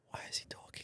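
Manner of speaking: hushed, voiceless aside; imitated whisper. Friend mimicking the Uber driver, whispering. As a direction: whisper it as a secret question.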